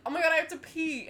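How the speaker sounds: high, loud panicked cry; urgent panic. Retold or imitated. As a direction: blurt it out in panic.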